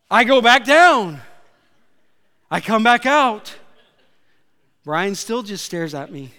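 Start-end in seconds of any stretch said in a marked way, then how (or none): 0.1-3.5 loud, high, urgent storytelling; raised panicked retelling. Retold or imitated. Preacher reliving his near drowning, loud and high. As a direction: push it out like you're still gasping for air.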